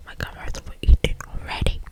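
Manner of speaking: plain reading voice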